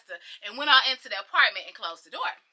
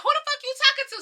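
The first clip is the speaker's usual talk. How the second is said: high-pitched indignant challenge; confrontational comeback; 13 semitones higher than usual. Retold or imitated. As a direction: cut in, ready to fight. Acted quarrel, snapping back.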